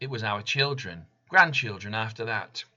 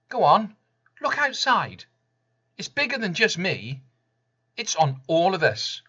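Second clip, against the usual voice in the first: impassioned call out; 8 semitones higher, 5 dB louder than usual. Acted. Impassioned appeal to listeners.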